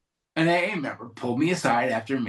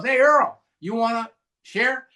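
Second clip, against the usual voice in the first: meeting leader calling out; 7 semitones higher than usual. Retold or imitated. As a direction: call on someone in a high, friendly holler. Meeting leader calls on him.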